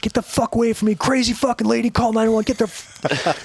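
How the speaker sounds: high-pitched retold rant; obnoxious mock outburst. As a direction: act like an ass chasing someone off. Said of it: "being an ass".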